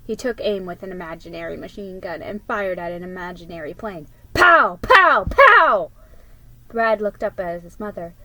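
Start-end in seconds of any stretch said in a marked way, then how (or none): 4.3-5.9 loud high pow pow pow; kid gun noises. Acted. Ricky shooting at an imaginary plane, loud gun noises. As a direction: blast the imaginary plane loudly.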